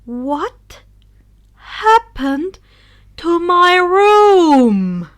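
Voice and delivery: loud, slow, rising furious demand; unnatural anger. Acted. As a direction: let the rage stretch each word. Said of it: "It wasn't natural and held anger".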